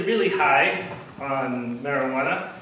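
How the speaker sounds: usual speaking voice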